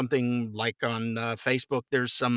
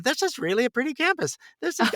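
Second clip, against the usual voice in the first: much higher, light and wistful, half to himself; dreamy daydreaming aside; 13 semitones higher than usual. Retold or imitated. Actor frozen on stage, admiring the campus, much higher and dreamy. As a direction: float off admiring the view. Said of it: "I'm looking around going".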